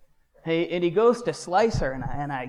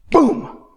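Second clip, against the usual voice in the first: loud, high imitated bang; explosive boom; 11 semitones higher, 10 dB louder than usual. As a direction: burst it out like a sudden impact. Sudden return into his body.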